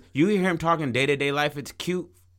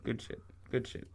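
hushed quote; 4 semitones lower, 15 dB quieter than usual. Retold or imitated. Quoting himself, hushed aside.

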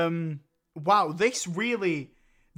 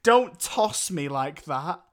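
slow, drawn-out mock moaning; hammed-up porn-star line, words stretched; porn parody; 7 dB louder, slower than usual. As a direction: play it as a slow, silly come-on.

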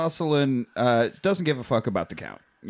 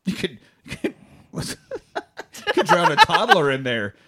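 laughing; slower than usual. Real, not acted. Laughing through a joke.

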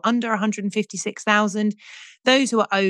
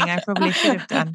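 ordinary talk

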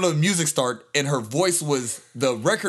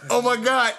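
high-pitched, louder shocked outburst; mock screaming; 11 semitones higher, 4 dB louder than usual. Recalling watching the fight on the couch, mock screaming. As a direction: cry out high, reliving the shock. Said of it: "screaming like".

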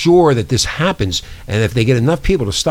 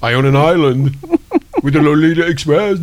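1 mock Epstein.

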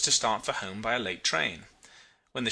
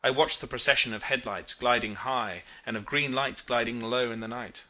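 ordinary delivery, nothing marked

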